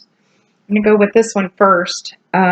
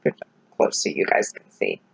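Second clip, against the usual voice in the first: whispering; 9 dB quieter, faster than usual. Real, not acted. Showing palette close to camera.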